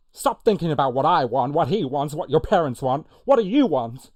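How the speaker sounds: high, very loud impassioned demand; serious film outburst. Acted. Host redoing a serious film argument, loud high demand. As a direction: press hard through the list into a shouted question. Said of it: "I'll do that one again".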